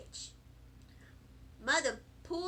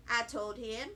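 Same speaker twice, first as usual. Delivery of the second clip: ordinary delivery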